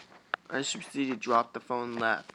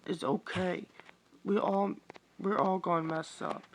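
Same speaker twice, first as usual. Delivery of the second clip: higher pitched reassuring plea; comforting Alexis voice; 7 semitones higher, 3 dB louder than usual. Acted. Alexis reassuring CJ, higher voice. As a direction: talk him down kindly.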